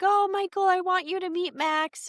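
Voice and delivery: high, bright-eyed gushing mother; excited mom voice. Retold or imitated. Mom introducing Max to her son, high excited gushing. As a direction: gush like a thrilled mom introducing her date.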